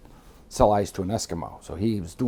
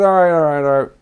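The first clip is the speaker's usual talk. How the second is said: loud, high repeated concession; placating; 7 semitones higher, 9 dB louder than usual. Retold or imitated. Men returning to the table after a misunderstanding, loudly placating. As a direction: imitate them backing down, raised voice. Retold group backing down at table.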